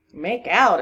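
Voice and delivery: loud, scornful echo; exclaiming. A narrator's reading. Constable echoing the woman's words, exclaiming. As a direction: throw the words back loudly. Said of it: "exclaimed the constable".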